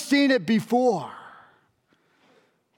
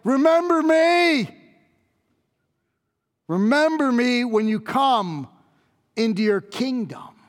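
agonized plea; 3 semitones higher than usual. Acted. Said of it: "in his agony".

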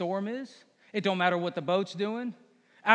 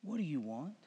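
quiet humble prayer; 5 semitones lower, 12 dB quieter than usual. Retold or imitated. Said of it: "We don't ask him".

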